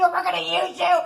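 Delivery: usual speaking voice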